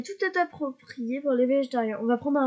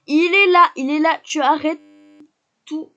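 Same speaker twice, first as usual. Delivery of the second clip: much louder, urgent; excited shout; 4 semitones higher, 10 dB louder than usual. Real, not acted. Streamer spotting Akira and ordering her Sim to stop, loud excited shout. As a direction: shout it as you spot him.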